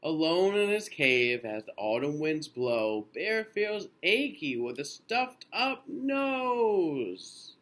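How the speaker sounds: lilting storybook read-aloud; gentle bedtime picture-book reading; reading to children. A narrator's reading. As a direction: read it slowly to little ones.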